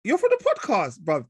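high, loud fan shout; thrilled to spot the podcaster; excited fan. Retold or imitated. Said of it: "and he goes".

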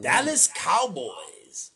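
slow stretched hype call; drawn-out announcer. Acted.

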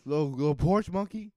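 high, loud comic insult; hammed-up hostile jeer; mock angry taunt. Comedian acts out neighbor insulting the driver, mock angry.

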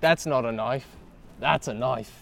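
movie-quote Aussie impression; put-on Australian drawl; mock Australian accent. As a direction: deliver it as a playful Australian catchphrase. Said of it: "he's turning Australian".

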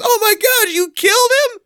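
very high imitated child's cry; mock-horrified kid. Kid learns his turkey is dead, high mock-horrified cry. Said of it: "And he goes".

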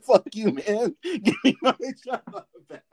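high mock yell breaking into laughter; laughing mock retort. Friend repeating the fired worker's retort, laughing. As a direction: laugh through the angry line.

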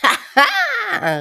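very high, loud cackling laugh; triumphant laugh. Acted. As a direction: crow with triumph. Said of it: "rief Makoje triumphierend".

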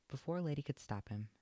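plain reading voice

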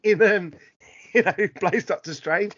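usual speaking voice

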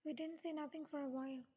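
plain reading voice

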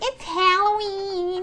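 drawn-out high "Halloween", HAL-oh-ween; mocked pronunciation. Co-host mocking the rival version, "Halloween" high and whiny. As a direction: whine "Halloween" slowly with a cat A. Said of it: "No, Halloween, it's like".

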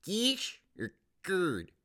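high, slow hammed Michigan vowels; exaggerated Detroit accent. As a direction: overdo the Detroit clerk. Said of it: "Why are you saying it's cash or card?".

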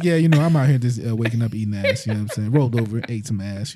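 lazy, unbothered retelling; mock casual. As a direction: play it totally nonchalant. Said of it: "Who casually just like".

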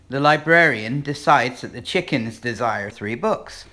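normal talking voice

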